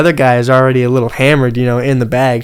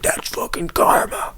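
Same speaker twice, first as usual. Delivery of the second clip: dad's smug aside, said under the breath; quietly pleased, almost voiceless; low murmur of satisfaction; 7 dB quieter, slower than usual. Retold or imitated. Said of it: "He's like".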